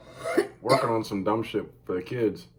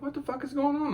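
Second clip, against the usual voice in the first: high, baffled mock outcry; startled by barking in the dark; puzzled exclamation; 3 dB quieter than usual. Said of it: "I'm like".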